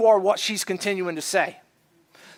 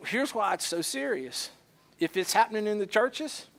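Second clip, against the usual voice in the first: ordinary talk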